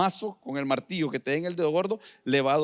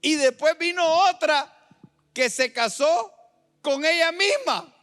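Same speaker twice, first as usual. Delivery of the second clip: high-pitched, raised proclamation; full-force preaching; 13 semitones higher, 6 dB louder than usual. Acted. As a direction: deliver the absurd case from the pulpit.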